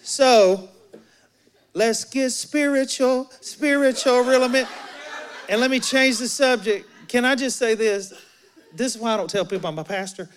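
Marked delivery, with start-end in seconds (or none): 0.0-8.1 raised, louder mock-spiritual urging; hammed-up deflection. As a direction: push the new subject, high and emphatic. Acts woman hastily steering to religion.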